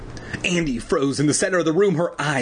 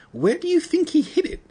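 put-on squeaky frightened kid voice; high scared boy. A narrator's reading. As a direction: pitch it up like a nervous boy.

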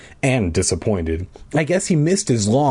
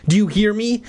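screeching; 9 semitones higher than usual. A narrator's reading. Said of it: "I screeched".

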